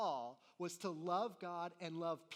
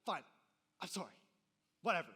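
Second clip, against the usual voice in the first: sulky fake apology; 7 dB louder, faster than usual. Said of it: "then you were like".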